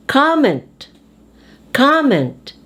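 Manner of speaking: "Comment" with "com" high; first-syllable stress. Shown as a demonstration. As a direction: hit the start of "Comment", then fall. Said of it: "on the first syllable".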